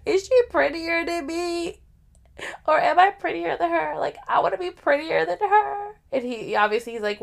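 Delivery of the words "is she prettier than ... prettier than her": jealous whine. Retold or imitated. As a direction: plead like a jealous girlfriend, pitched way up. Jealous self-quote about husband's ex.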